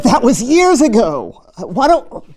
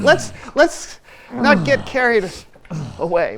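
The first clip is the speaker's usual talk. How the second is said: ordinary talk, nothing marked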